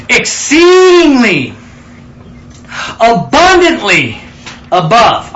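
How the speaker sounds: exceedingly stretched out high and loud, abundantly and above pressed slow; emphatic preaching. Acted. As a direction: drag out exceedingly big and slow, like proclaiming it. Preacher stressing key scripture words.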